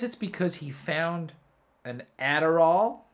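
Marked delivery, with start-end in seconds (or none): 1.8-3.0 put-on "an Adderall" in a light American accent, louder. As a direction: say "an Adderall" the American way, stretched ADD-er-all. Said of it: "I just like to say that in an American accent".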